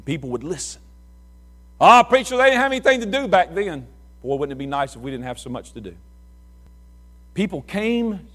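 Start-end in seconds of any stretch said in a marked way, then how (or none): 1.8-3.9 raised, louder scoffing voice; mocking old excuse. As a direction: sneer it as a dismissive churchgoer. Mocking what people say of Moody.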